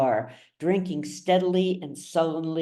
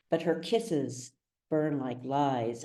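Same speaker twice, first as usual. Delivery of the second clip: ordinary delivery, nothing marked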